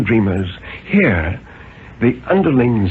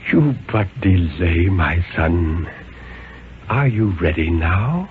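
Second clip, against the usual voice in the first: low, soft gentle chiding; 6 semitones lower, 3 dB quieter than usual. Acted. The Ancient One chiding Marston who tried to flee, low and soft. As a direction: scold him kindly, low and hushed. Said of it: "the chiding voice of the Ancient One".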